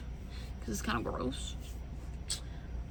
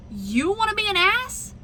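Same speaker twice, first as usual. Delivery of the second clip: sassy challenge; 9 semitones higher than usual. Retold or imitated. Retold challenge to rude driver.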